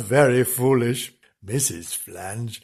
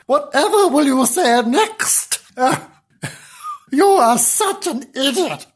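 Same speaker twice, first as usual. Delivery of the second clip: high exasperated character voice; scornful exasperation; 10 semitones higher than usual. Acted. Fed-up character berating an idiot in a story, scornful. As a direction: play a high-pitched, fed-up scold.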